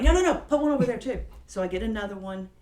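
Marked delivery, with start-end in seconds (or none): 0.0-1.2 fast, loud, high-pitched instruction; retold urgent order. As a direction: mimic someone hurriedly redirecting a helper. Retold driver ordering sign placement.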